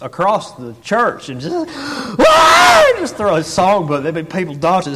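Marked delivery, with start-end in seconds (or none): 1.8-2.9 high, loud wild yell; outburst yell. Retold or imitated. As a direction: imitate a wild cry while hurling something. Acting out songbook thrower's outburst.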